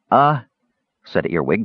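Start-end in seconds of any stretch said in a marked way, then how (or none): 0.1-0.4 higher, uncertain filler; small character's baffled murmur; confused. A narrator's reading. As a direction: hesitate in puzzlement. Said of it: "growing more and more confused".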